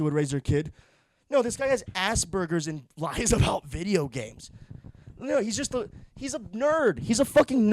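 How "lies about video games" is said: normal talking voice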